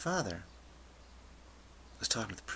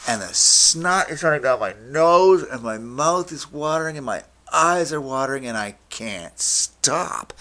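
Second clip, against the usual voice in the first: loud, higher, overwhelmed wail; helpless hysteria; 5 semitones higher, 10 dB louder than usual. Retold or imitated. Teen on acid losing control in class, loud wailing hysteria. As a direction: pour it out loudly, swept away by the fit. Acting out helpless laughing fit.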